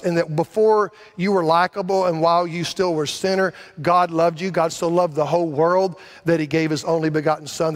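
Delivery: ordinary delivery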